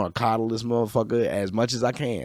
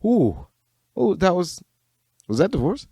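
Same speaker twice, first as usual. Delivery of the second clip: mock surprise; 9 semitones higher than usual. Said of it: "I was just like".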